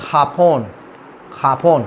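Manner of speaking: "खापोन" opening on kh instead of j. Shown as a demonstration. Teacher turning Japan into Spanish, J as kh. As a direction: model "खापोन" with falling tone and throaty start. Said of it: "जापान क्या हो जाएगा".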